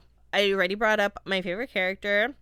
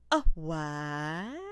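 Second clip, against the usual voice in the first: feminine "a what"; 5 semitones lower than usual. Retold or imitated. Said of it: "a very feminine, "A what?".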